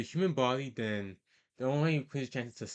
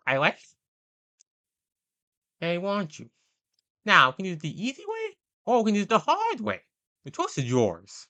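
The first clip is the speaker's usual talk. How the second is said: loud, high-pitched mock ultimatum; Fleece Johnson; 8 semitones higher, 7 dB louder than usual. Host acting out Fleece's infamous question, loud high impression. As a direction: build from a proposition to a fast, loud threat. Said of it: "he asks after the infamous question".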